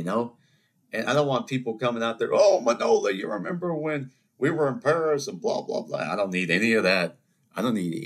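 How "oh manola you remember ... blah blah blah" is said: gushing acquaintance. Pretend.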